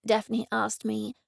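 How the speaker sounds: ordinary delivery, nothing marked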